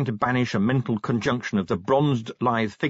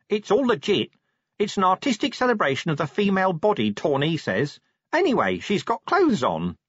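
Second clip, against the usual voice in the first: matter-of-fact, pitched up; chatty Nobby; 10 semitones higher than usual. Acted. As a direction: reassure your sergeant in a perky common voice.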